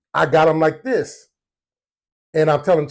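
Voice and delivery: ordinary talk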